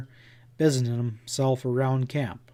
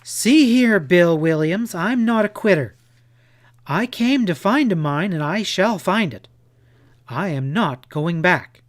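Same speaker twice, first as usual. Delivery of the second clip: higher and louder, indignant outburst; angry; 5 semitones higher, 6 dB louder than usual. Acted. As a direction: stand your ground, furious and proud. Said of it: "her blue eyes sparkling with anger".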